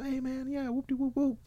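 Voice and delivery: high, soft brushing-off voice; high, soft mock of an airy excuse. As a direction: brush it off like a deadbeat. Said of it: "People be say".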